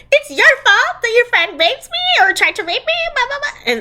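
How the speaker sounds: very high, whiny accusation; mock outraged arguing. Friend acting the heroine's fight on the boat, mock outrage. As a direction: hurl the blame back in a high mock voice.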